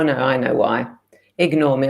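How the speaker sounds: normal talking voice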